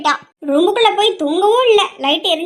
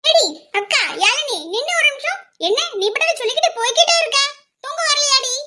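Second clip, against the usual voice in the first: calling younger sister; 6 semitones higher than usual. Acted. Younger sister calls after elder.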